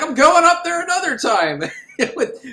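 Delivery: giggling mock vow; laughing quote. Real, not acted. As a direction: crack up while quoting him. Laughing while quoting.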